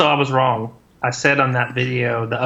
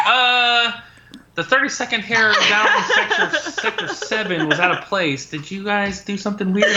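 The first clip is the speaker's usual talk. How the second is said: lightly voiced nitpicking viewer, higher and laughing; half-laughing mock of a picky commenter; fussy viewer; 7 semitones higher than usual. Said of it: "someone's like".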